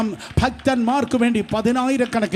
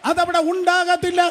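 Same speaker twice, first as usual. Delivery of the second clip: full-force preaching; 8 semitones higher than usual. Acted. Preacher proclaiming God's message to the congregation, shouting. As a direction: cry each line out to the congregation like a prophet.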